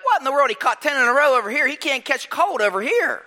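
high, exasperated disbelief; frustrated coach. Retold or imitated. Coach frustrated his receiver keeps missing, high exasperated disbelief. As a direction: vent in disbelief. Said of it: "I'm like".

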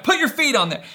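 insist, acting out rising frustration; frustrated ordering. Dad re-enacting pushing his toddler to pedal, frustrated. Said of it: "I'm, like, getting frustrated".

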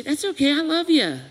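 put-on a loving father soothing his child; gentle comforting God. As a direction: reassure a frightened child lovingly. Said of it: "God would look at you and say".

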